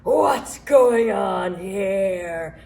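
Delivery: put-on grumpy old Crooked Root demanding; old gnome. A narrator's reading. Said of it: "he asked".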